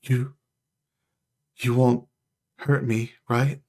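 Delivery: halting, wary little question; hesitant plea for reassurance; timid celestial. Acted. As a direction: ask cautiously, long gaps between words. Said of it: "peers out from behind Essa".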